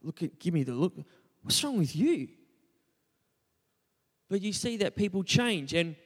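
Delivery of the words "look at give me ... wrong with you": a hint of an exasperated father, rising at the end; mild imitation of a fed-up dad; lightly voiced scolding dad. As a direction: toss off dad's irritated question. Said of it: "Dad's like".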